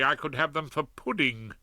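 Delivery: ordinary delivery, nothing marked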